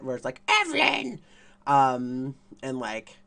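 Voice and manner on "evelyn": high, loud sing-song name call; Evelyn calling her name. Retold or imitated. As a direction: call out your own name grandly. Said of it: "says her name a lot".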